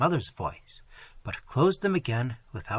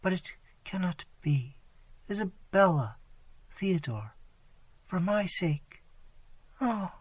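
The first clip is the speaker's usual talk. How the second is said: struggling; 4 semitones higher, 5 dB quieter than usual. Acted.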